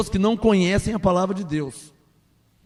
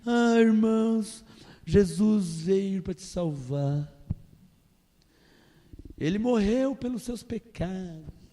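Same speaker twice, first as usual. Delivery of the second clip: softer, lower and slow, a limp sermon; timid soft preaching; 4 semitones lower, 8 dB quieter, slower than usual. Shown as a demonstration. Pastor mocking a timid preacher, slow and soft. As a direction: preach it weakly, with no authority. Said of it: "Eu não posso falar a verdade assim".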